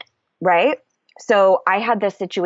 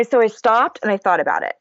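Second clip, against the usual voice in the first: ordinary talk, nothing marked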